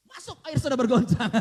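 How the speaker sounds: loud, triumphant call; half-joking loud cry; mock announcement. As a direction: proclaim the water has stirred. Mock self-shaking the water.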